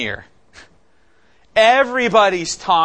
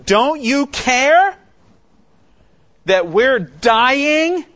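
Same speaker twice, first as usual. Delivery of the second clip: high, loud, slow accusing cry; accusing shout; 10 semitones higher, 6 dB louder, much slower than usual. Retold or imitated. Disciples confronting sleeping Jesus in the storm, a loud accusing cry. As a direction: fling the accusation at him, drawn out and desperate. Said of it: "really confront him with an accusation".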